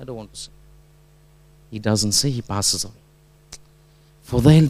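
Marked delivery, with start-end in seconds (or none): 0.0-0.5 retold weak refusal. Dying student refusing his teacher's prompt, quiet refusal.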